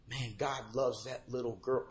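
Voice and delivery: put-on realising God's love while watching a little girl, hushed; hushed wonder. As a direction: say it quietly in wonder. Quiet awestruck realisation.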